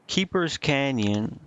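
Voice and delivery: ordinary talk, nothing marked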